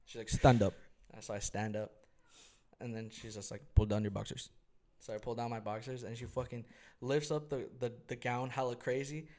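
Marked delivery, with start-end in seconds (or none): 0.3-0.7 loud mock command; brusque order. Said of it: "This fucking Russian lady comes in". 3.8-4.5 louder mock order; brusque Russian lady. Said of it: "This fucking Russian lady comes in".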